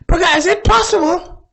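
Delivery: hammed-up high girl voice, ends in a laugh; mock drunk sister. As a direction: squeal it like a confused tipsy girl. Sister's drunk question retold.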